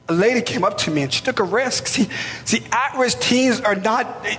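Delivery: climbing sermon fervor; impassioned preaching. Real, not acted. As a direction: lift the congregation, pleading with them. Preacher rising to a peak.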